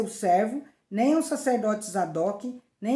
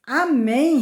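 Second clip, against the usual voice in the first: loud, higher, resounding amen; emphatic assent; 5 semitones higher, 8 dB louder than usual. A narrator's reading. Benaia answering the king's decree, emphatic assent. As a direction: proclaim your agreement loudly.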